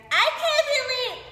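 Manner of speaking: high, loud mock whine; whiny. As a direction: whine like you're giving up. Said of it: "the naggy, the bitchy, the whiny".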